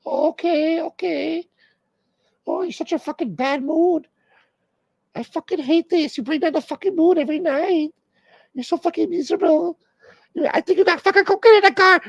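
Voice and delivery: hammed-up girlfriend nagging in falsetto; wife's voice. Comic voices [name] nagging [name], high mock girlfriend voice.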